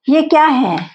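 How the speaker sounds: usual speaking voice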